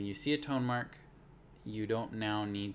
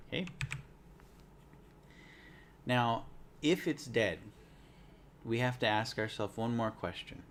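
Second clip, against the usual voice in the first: normal talking voice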